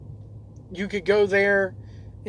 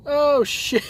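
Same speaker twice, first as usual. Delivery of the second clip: cracking up, breaking into a high laugh; laughing hard; 6 semitones higher than usual. Real, not acted. Trucker reading a viewer comment, bursting out laughing. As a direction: lose it laughing mid-read. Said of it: "I can't even read this".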